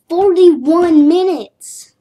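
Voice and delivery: loud, slow disbelieving shout; shocked exclaim. Real, not acted. Gamer realizing how long he played, loud slow disbelief. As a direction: stretch it out in disbelief.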